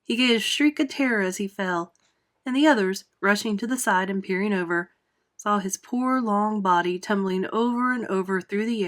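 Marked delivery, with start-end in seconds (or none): none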